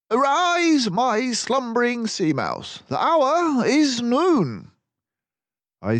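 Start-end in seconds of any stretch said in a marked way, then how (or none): 0.1-4.7 high, loud, mannered detective voice; Basil's voice. Acted. Basil reassures gloomy Dawson on the train, high and hearty. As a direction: play the confident mouse detective.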